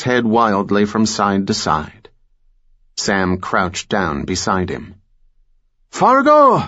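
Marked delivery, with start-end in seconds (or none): 5.9-6.6 screaming. A narrator's reading. Blinded villain screams again.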